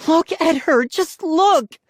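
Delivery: high, loud little-sister scream. A narrator's reading. Sister showing her damaged doll, screaming. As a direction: scream it in outrage. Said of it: "Molly screamed".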